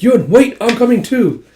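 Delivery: high, loud urgent call; a loud pleading call to wait. Retold or imitated. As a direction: shout after him as he leaves. Yona calling after Yun.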